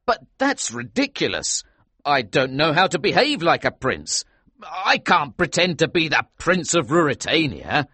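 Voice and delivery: protesting cry. A narrator's reading. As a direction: protest in astonished disbelief. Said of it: "I cried".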